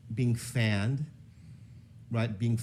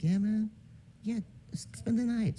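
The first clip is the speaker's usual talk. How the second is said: put-on high excited kid; 12 semitones higher, 5 dB quieter than usual. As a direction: answer eagerly, high. [name] answering excitedly.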